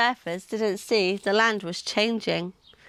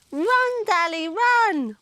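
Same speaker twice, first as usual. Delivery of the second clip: high, urgent call to run; urgent cry; 9 semitones higher, slower than usual. Acted. Sid yells at Dally to run from the meat eaters. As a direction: urge him to flee, high and strained. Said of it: "screamed Sid".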